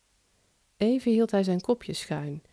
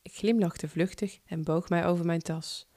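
usual speaking voice

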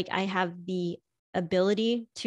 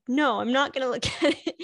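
quick, higher refusal through laughter; laughing refusal; 5 semitones higher, faster than usual. Retold or imitated. Wife refuses to check the app, laughing and quick. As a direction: refuse him while laughing. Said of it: "And I'm like".

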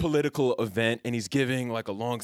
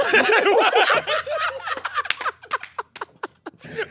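high loud burst of laughter; real howling laugh; big laugh; 5 dB louder than usual. Real, not acted. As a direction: crack up hard. Laughing at the story.

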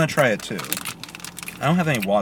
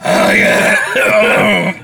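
loud, higher mock "Oh, yeah"; unenthusiastic oh yeah; 8 semitones higher, 8 dB louder than usual. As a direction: echo the reluctant "Oh, yeah". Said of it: "You did say".

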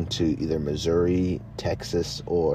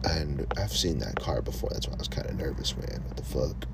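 whispered, much softer and hurried; 5 semitones lower, 9 dB quieter than usual. Real, not acted. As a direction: hush it like you're being watched. Nervous aside about the car.

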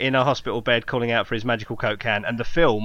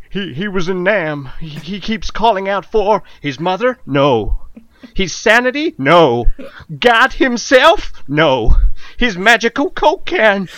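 higher and louder with each question; building shout; 7 semitones higher, 8 dB louder, slower than usual. Pretend. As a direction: climb to a frantic yell. Trailer climax, rising questions.

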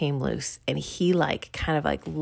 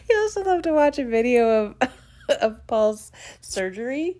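laughing; 8 semitones higher, 3 dB louder than usual. Real, not acted. Giggling through the memory.

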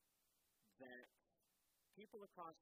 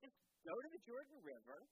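much higher, coaxing servant voice; pleading servants; 10 semitones higher, 3 dB louder than usual. Acted. Servants reasoning with the angry general, high coaxing voice. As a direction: talk the master down gently but firmly. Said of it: "his servants say to him".

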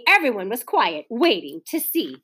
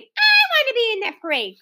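clamoring; 12 semitones higher than usual. A narrator's reading. Children clamoring again to join the parade. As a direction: insist even harder. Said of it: "cried the others".

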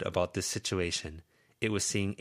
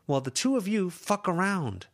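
put-on higher young woman's voice, frustrated and pleading; Lucy's voice; 11 semitones higher, 4 dB louder than usual. Lucy scolding her two friends, higher frustrated voice.

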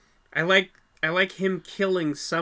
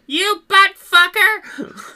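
put-on high, slow screaming kid; yelling; 12 dB louder, much slower than usual. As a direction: shriek it like an angry little girl. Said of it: "him and yells".

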